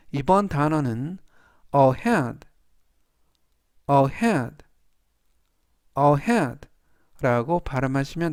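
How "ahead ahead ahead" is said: ahead with HEAD hit firmly; stress on the E. Shown as a demonstration. Teacher modelling ahead for learners, stress on HEAD. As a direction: say ahead three times, stressing the E in the middle. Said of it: "이의 강세가 있습니다".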